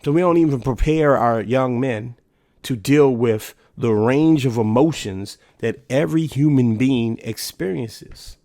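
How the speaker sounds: ordinary delivery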